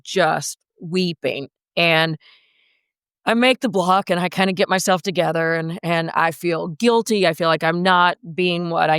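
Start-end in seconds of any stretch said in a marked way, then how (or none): none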